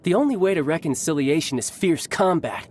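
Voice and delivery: ordinary talk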